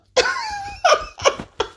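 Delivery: squeaky high laugh. Real, not acted. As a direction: let out a high giggle. Laughs at own joke.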